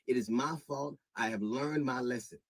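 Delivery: normal talking voice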